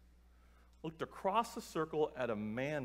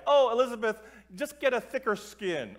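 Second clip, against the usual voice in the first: higher, louder mock-teacher brush-off; dismissive teacher; 6 semitones higher, 7 dB louder than usual. Teachers brushing off a student who asked for help, dismissive. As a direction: imitate a teacher waving away a complaint.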